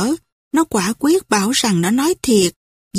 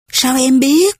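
startled, slightly louder question; taken aback; 3 semitones higher, 3 dB louder than usual. A narrator's reading. Quyên stunned by news of Sâm, startled question. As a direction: caught off guard, asks at once. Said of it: "Quyên chưng hửng".

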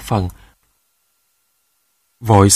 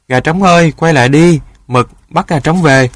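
put-on high, louder child's call; calling after; 5 semitones higher, 4 dB louder than usual. A narrator's reading. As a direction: holler after the runaway rooster. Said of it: "kêu với theo". (kêu với theo, giọng cao)

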